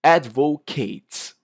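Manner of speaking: ADVOCATES drawn out, ending on a clear T sound; slow ADVOCATES with the T sounded; pronounced T ending. Shown as a demonstration. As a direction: say ADVOCATES slowly and release the T. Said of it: "you pronounce with a T sound".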